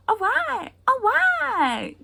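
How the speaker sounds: put-on squeaky, high and loud toddler whine. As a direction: mimic a two-year-old, way up high. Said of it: "she repeats things".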